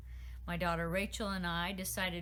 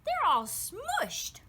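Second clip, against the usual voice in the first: very high, slow, louder dismay; dismayed pixie; 12 semitones higher, 6 dB louder, much slower than usual. A narrator's reading. Pixie Nawadi to Royce over the flowers, very high and slow. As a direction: squeak it out in dismay, slowly.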